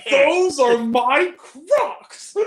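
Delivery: high, loud, slow laughing meme line; meme shout. Retold or imitated. Answering his own meme question, slow high laughing shout. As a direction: drag out the reply like the meme.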